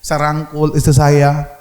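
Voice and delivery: normal talking voice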